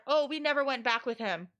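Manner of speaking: usual speaking voice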